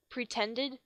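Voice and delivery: usual speaking voice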